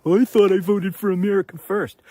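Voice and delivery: high, loud whiny mimicry; mock whining. Critic mocking Rand's excuse to his face, whining. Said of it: "to start whining about".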